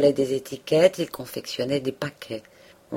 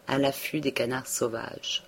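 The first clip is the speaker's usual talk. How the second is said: usual speaking voice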